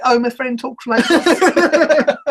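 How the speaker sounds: put-on high, drawn-out London accent; mock London accent. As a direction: exaggerate a silly London accent, slowly. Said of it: "You sound like you're from London".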